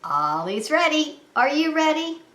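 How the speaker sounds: normal talking voice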